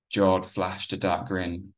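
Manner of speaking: ordinary delivery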